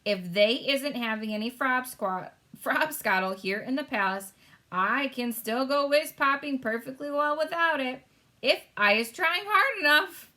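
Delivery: a mischievous giant scheming; sly giant. A narrator's reading. Giant telling Sophie his plan, sly. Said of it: "he said".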